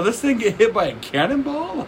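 high-pitched incredulous question; mock disbelief. Retelling the cannonball joke to co-host, mock disbelief. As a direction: shoot the pitch up in comic disbelief. Acting out Bill Burr's disbelief.

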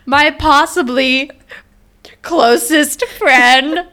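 slow, drawn-out mock outrage; milk the betrayal slowly. Real, not acted. Teasing friend over birthday.

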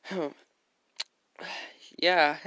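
usual speaking voice

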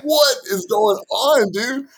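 high, slow mock bafflement; retelling his shock in the truck, high slow bewilderment; bewildered. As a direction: play up the confusion, slowed. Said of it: "I'm just like".

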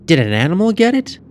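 put-on high, quick child's question; questioning girl. A narrator's reading. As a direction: ask fast in a high girl's voice. Girl questions her aunt.

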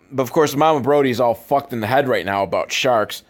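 ordinary delivery

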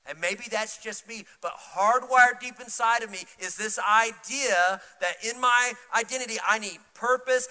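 loud, high, impassioned preaching; full-force preaching. Real, not acted. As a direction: press the congregation with rising urgency.

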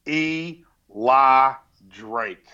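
dramatic slow reveal. Acted.

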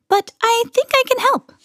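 put-on very high, sweet old-woman voice; bright. A narrator's reading. Old woman offering to help the Creams, bright. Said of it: "she said brightly".

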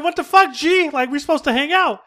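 high, put-out complaint; indignant friend. Retold or imitated. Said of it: "friend number two's like".

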